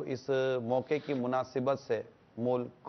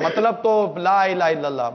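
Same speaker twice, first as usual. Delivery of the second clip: 1 fervent declaration; 4 semitones higher, 8 dB louder than usual. Real, not acted. Fervent slogan on Independence Day.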